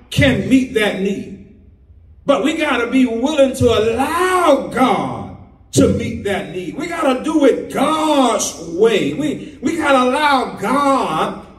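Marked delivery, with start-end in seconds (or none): 2.2-11.4 high, fervent pulpit shouting; preaching at full force. Acted. Preacher shouting to the church that God must be pilot. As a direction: rouse the congregation.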